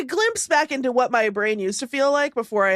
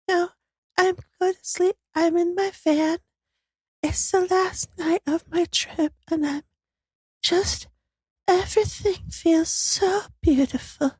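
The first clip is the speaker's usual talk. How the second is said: nodding off; 4 semitones higher than usual. Retold or imitated. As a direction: drift off as you speak. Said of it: "it sounds like this".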